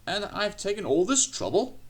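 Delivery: high-pitched indignant outburst; almost shriek it in outrage; near shriek. A narrator's reading. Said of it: "he almost shrieked".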